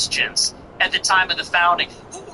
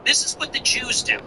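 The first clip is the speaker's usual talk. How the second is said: ordinary talk, nothing marked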